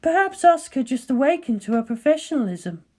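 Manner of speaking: cool, measured colleague; Ritsuko's voice. Acted.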